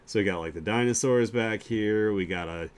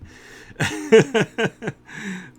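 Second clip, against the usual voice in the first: long, high, loud cackling laugh; real laugh; 11 semitones higher, 6 dB louder than usual. Real, not acted. Streamer cracking up at their own dinosaur joke, laughing.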